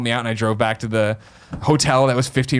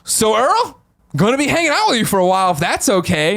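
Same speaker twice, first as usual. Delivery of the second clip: much higher, sheepish and apologetic; 10 semitones higher, 3 dB louder than usual. Retold or imitated. To Earl, asking to hang out a while: high sheepish voice. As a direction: squeak it out like an awkward guest.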